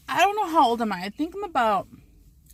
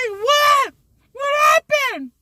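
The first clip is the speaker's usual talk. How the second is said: shrieking high panicked mother; panicked mom; 8 dB louder, much slower than usual. Retold or imitated. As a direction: shout like a frantic mom. Mom voiced panicking, shouting.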